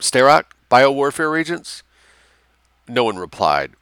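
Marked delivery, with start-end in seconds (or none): 0.0-1.8 high, sharp, pointed questions; snapped. A narrator's reading. Lisa presses the room on biowarfare agents, snapped. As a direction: throw each question out curtly. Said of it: "Lisa snapped".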